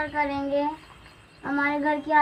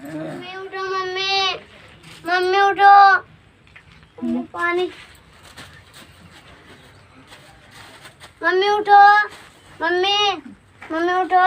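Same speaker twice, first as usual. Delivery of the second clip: desperate calling; 3 semitones higher, 6 dB louder than usual. Acted. Daughter calls unconscious mother.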